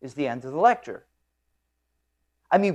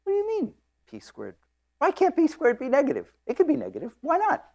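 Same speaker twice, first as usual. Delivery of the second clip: higher, raised pitch for a challenging student; eager student; 13 semitones higher than usual. Retold or imitated. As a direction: play the bright student pushing back. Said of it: "And the student says".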